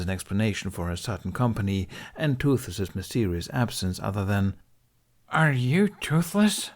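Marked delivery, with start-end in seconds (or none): 5.3-6.7 slow, lightly voiced Hiccup, baffled; lightly voiced young man, asking something dumb; a hint of a confused boy; asking stupidly. A narrator's reading. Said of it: "he asked stupidly".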